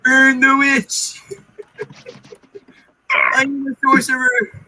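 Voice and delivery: high, slow mob shriek; angry mob shout. Pretend. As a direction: screech it like a peasant mob. Monty Python mob quote.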